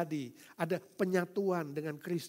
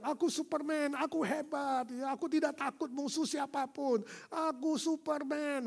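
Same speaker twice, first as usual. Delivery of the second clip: higher child's boast, voiced by an adult; boy playing Superman; 9 semitones higher than usual. Pastor voicing a small boy jumping on chairs, high boastful child. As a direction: brag like a little boy in a cape.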